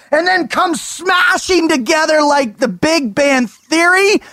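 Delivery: high, shouting preacher-style hype; hyped up. Acted. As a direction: preach it to the rafters. Hyped-up intro speech.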